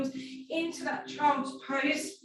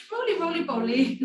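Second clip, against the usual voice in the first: playful singsong; 6 semitones higher, 4 dB louder, faster than usual. Real, not acted. Playful rhyme while rolling over.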